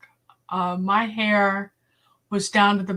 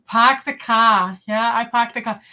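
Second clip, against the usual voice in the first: broad Boston accent, dropping the r's; 5 dB louder than usual. Shown as a demonstration. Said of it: "I had a Boston accent".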